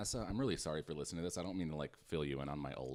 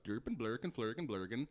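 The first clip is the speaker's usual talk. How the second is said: mock Swedish gibberish; 6 semitones higher than usual. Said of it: "make fun of Swedish".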